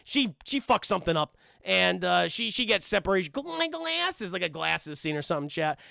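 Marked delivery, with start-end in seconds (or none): 3.3-4.3 higher, softer cartoon whimper; pitched-up helpless impression; Velma. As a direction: fumble it in a squeezed high voice. Imitating Velma losing her glasses.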